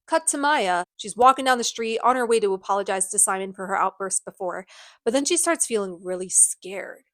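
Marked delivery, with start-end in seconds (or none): none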